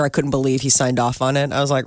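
ordinary delivery

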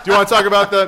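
loud, high mock prompt; mock awkward question. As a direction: nudge your partner awkwardly. Mocking their awkward duo.

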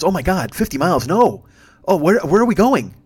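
high, loud burst of mock panic; alarmed rider. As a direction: imitate a rider thrown into a panic. Retold rider alarmed at the distance.

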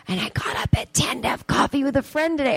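high whispered confession. Real, not acted. Comic whispering the confession of getting up at ten. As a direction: whisper it like a naughty secret. Confessing late night, saving voice.

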